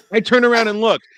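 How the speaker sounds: high, loud comic exasperation. Retold or imitated. Same line repeated, escalating.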